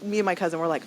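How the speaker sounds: ordinary talk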